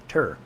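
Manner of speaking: "Tur" alone, with a clear t; isolated syllable. Shown as a demonstration. Streamer testing the end of water, isolated "Tur". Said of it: "are very close".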